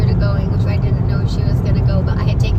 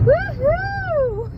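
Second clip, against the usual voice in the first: very high real whoop of glee; squealing woohoo, much higher; excited whoop; 3 dB quieter than usual. Real, not acted. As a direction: let out a giddy cheer. Cheering about the male revue show.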